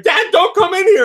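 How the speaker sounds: high, loud cry; hammed-up squeaky alarm; retold panicked warning. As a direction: call out to keep him away. Retold warning called to dad.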